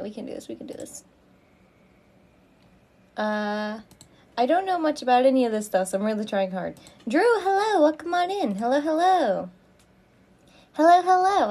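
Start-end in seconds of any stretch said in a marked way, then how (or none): 0.0-1.0 fast whispered self-pep talk; whispering. Real, not acted. Whispered self-encouragement while fixing. 7.1-11.5 excited greeting. Real, not acted. Streamer greets a new viewer.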